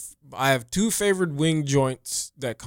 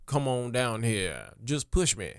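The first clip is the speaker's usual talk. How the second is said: Todd voice; 4 semitones lower, 11 dB quieter than usual. Acted. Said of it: "It's me, Todd".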